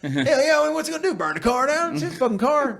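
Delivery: high-pitched, amused mock justification; joking devil-may-care. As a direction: toss it off in a high, who-cares voice. Joking reckless self-talk while retelling.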